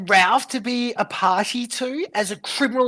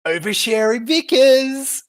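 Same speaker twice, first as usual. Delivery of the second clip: mocking nickname; 5 semitones higher, 8 dB louder than usual. Real, not acted.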